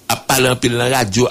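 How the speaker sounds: normal talking voice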